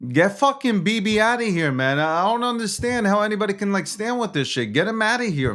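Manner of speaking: high-pitched, heated protest; exasperated rant. Real, not acted. Host demanding that Bibi be thrown out, exasperated.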